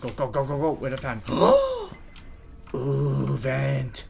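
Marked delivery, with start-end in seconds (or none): 1.3-2.7 sudden very high gasping shriek. Real, not acted. Gamer finding the vent, startled high gasp. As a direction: yelp in fright. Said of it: "I don't know why I said it like that".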